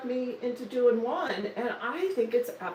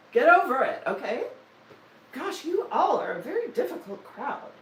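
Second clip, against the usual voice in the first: playful exasperation, pitched up, punchy start; teasing telling-off, higher voice; mock scolding; 6 semitones higher, 5 dB louder than usual. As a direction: scold the audience in jest. Mock scolding the live viewers.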